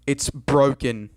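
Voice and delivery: BROKEN punched loud and drawn out; slow down and hit "broken" hard; exasperated insistence. Retold or imitated. Exasperated insistence to doubting mum.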